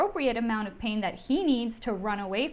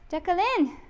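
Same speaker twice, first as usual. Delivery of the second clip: high drawn-out call across the room; 10 semitones higher, slower than usual. Real, not acted. Host calling her dog Jacqueline to come join, high drawn-out call.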